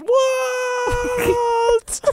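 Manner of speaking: very high, drawn-out comic scream; mock falling wail. Michael falling down the mountain yelling for Walt, comic high scream. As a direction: scream it as if tumbling away. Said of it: "as he falls down the mountain, he goes".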